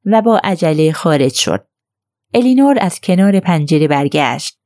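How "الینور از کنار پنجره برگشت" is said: usual speaking voice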